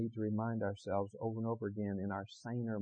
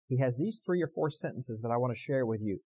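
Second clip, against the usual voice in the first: usual speaking voice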